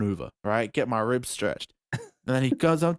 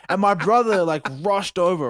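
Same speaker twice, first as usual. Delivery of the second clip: normal talking voice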